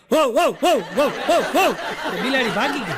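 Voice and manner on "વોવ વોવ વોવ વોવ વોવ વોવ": loud mock dog barks, wow wow; dog barking. Mouse father mimics a dog on the mic, loud barking. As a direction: bark like a dog into the mic to scare a cat. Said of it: "Mike લઈને એને કૂતરાનો અવાજ કાઢ્યો".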